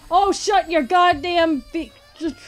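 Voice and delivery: high, loud furious outburst; angry yell. Real, not acted. Player raging at a monster. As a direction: yell at the game in rage. Yelling at game.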